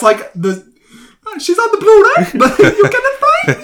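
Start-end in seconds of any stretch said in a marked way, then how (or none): 1.4-3.6 high squeaky laughing voice. Real, not acted. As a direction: giggle through the words. Laughing high-pitched joking.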